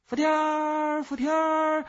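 high, loud, long-drawn insect call; shrill out each cry like a cicada, stretched and held high. A narrator's reading. Pet cicada calls its own name, piercing drawn-out cry. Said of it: "树枝上的知了也跟着".

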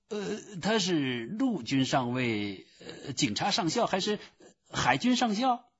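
high-pitched, hesitant clerk voice; high voice climbing into a question; puzzled phone clerk. A narrator's reading. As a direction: guess uncertainly in a high voice. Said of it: "另一个新的声音".

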